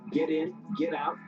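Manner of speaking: normal talking voice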